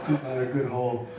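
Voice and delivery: said through laughter; laughing. Real, not acted. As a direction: laugh while getting the words out. Laughing at own joke.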